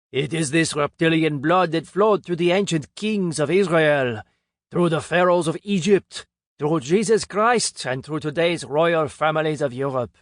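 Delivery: put-on a pompous Frenchman revealing alien bloodlines, French accent. A narrator's reading. French speaker telling the conference crowd, heavy French accent. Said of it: "In his French accent".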